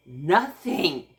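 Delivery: nothing landed loud and firm; stress on nothing. Real, not acted. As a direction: hit the word nothing hard. Hoping nothing will happen.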